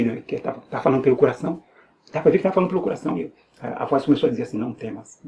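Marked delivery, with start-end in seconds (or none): 4.6-5.2 soft, low imitation of a divine voice; hushed, lower retold words; barely audible voice. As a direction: drop the voice right down, retelling a faint call. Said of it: "quase não dá pra ouvir".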